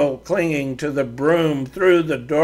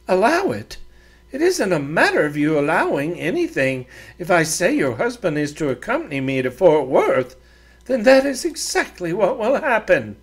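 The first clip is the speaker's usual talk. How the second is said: higher, prim and demanding; a proud woman giving orders; haughty lady voice; 4 semitones higher than usual. Acted. Doreen insists.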